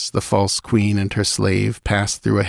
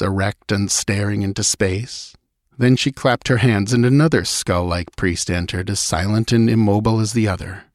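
ordinary delivery

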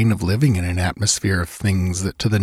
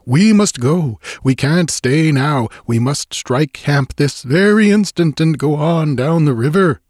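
urgent, higher-pitched pleading; helpless, frantic; 6 semitones higher, 4 dB louder than usual. A narrator's reading. The Swede begging to strike camp at once, frantic. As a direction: plead frantically to get away. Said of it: "he said in a sort of helpless, frantic way".

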